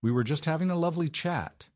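high, strained false brightness; a nervous put-on smile; forced cheerful. A narrator's reading. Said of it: "attempting a smile".

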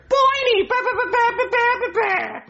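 very high squawking falsetto; Brooklyn Guy's wife. Shown as a demonstration. Puppeteer proving he can do the wife, high squawk. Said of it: "you can't do Brooklyn Guy's wife".

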